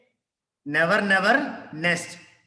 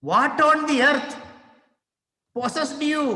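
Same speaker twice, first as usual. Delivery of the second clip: high, loud what-on-earth outburst; incredulous husband; 8 semitones higher, 3 dB louder than usual. Acted. Husband confronts wife over the money, high and incredulous. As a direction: demand an explanation in disbelief. Teacher performs husband's line.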